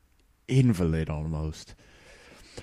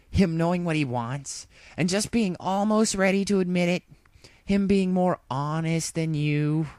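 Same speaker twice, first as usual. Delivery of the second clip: high, sly needling voice; Rainbow teasing; 12 semitones higher than usual. A narrator's reading. Rainbow needling Applejack about honesty, high and sly. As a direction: poke at her secret.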